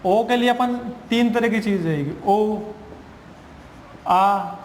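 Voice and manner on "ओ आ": O sounded two ways, "ओ" then "आ", with a pause between; letter sound demo. Shown as a demonstration. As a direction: give "ओ" and "आ" as separate vowel sounds. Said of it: "ओ को किस तरह से उच्चारण करेंगे".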